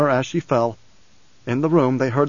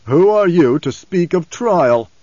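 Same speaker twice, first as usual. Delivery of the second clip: querulous autocratic man, loud and drawn out; Baron Harkonnen; 4 semitones higher, 6 dB louder, slower than usual. A narrator's reading. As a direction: demand slowly with self-indulgent scorn. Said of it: "that of a querulous man".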